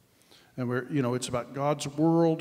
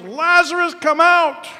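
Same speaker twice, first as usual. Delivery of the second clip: full-voiced shout, much higher and louder; yelling command; 14 semitones higher, 14 dB louder, slower than usual. Preacher acting Jesus calling Lazarus from the tomb, huge shout. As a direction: call him out of the tomb at the top of your voice. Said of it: "he yells".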